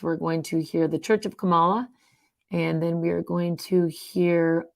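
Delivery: usual speaking voice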